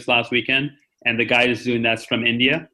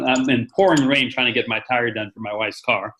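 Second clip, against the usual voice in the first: normal talking voice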